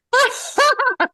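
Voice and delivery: high, loud burst of laughter; helpless high laugh; real laugh. Real, not acted. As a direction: burst out laughing at your own joke. Joking about bad breath.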